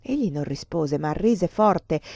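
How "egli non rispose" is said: ordinary delivery, nothing marked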